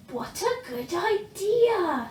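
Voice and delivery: drawn out with delight; eager young dragon; Zog pleased. A narrator's reading. Zog answers brightly.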